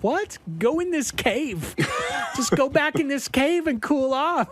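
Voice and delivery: high, breezy mock voice; shooing her into the cave; dismissive bandmates. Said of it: "the band's just like".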